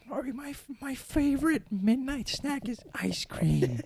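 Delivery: put-on high, slow, singsong old-lady voice; grandma accent. Grandson imitating his grandma's midnight snack line, high and singsong. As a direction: sing it out like a sweet old grandma. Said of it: "She's like".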